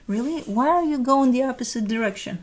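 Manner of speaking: ordinary talk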